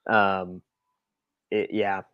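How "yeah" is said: ordinary delivery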